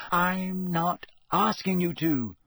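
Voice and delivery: exasperated, drawn-out insistence; impatient. A narrator's reading. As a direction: spell it out with impatience. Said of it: "Hermione said impatiently".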